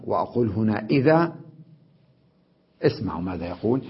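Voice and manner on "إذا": إذا in pausal form, tanwin dropped; pause on alif. Shown as a demonstration. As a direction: say إذا with a long final aa. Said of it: "إذا كتبناها إذن بالألف".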